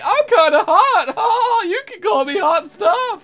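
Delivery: high playful come-on voice; hammed-up flirt; flirty object voice.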